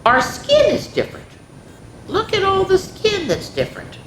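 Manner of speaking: high, animated story voice; excited reading. A narrator's reading. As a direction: lift it up with wonder.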